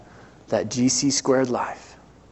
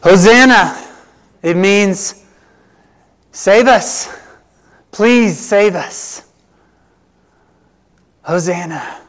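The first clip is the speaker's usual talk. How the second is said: preaching cry; 5 semitones higher, 7 dB louder, slower than usual. Acted. Crying out hosanna in sermon.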